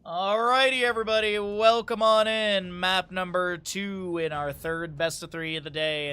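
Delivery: bright hosting voice welcoming everyone in; caster intro. Acted. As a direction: open the match like a host greeting the crowd. Opens the match like a caster.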